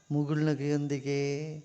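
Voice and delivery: ordinary delivery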